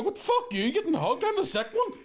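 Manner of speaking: shrill high indignant squawk; outraged, way up high; hammed-up jealous disbelief; mock outrage. Jealous outrage over hugs.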